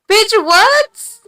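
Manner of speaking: high, loud shocked squeal; shocked disbelief. Real, not acted. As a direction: shriek it in disbelief. Reacting to mystery purple drink.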